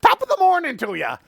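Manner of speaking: high fast Irish leprechaun voice; squeaky Irish greeting; Irish accent. Acted. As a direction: chirp it like a tiny Irish jig dancer. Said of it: "looks at you all and goes".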